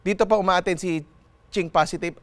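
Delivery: normal talking voice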